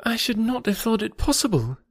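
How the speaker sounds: high-pitched, hurt disbelief; upset young friend, voice high; agitated. A narrator's reading. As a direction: begin in an agitated, lifted voice. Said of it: "in a voice nearly as agitated as Emma's".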